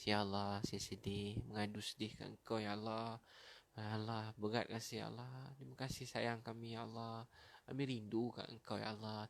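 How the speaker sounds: hushed, intimate prayer; soft supplication. Shown as a demonstration. Preacher showing viewers how to pour out sorrow, soft supplication. Models a tearful prayer to Allah.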